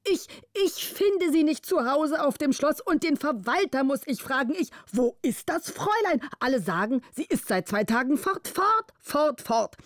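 hoher, zorniger Vormund; er schimpft, stampft bei jedem fort; zürnend. A narrator's reading. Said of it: "Zürnend fuhr er fort". (zorniger Vormund, höher gesprochen)

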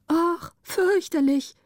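high, drawn-out horror; slow, high-pitched dismay at the sight; horrified. A narrator's reading. Lookout sees a frightful man. (entsetzt, hoch und gedehnt)

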